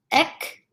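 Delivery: "ek" cut short with a tight pull; brief "ek". Shown as a demonstration. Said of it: "that short pull tight".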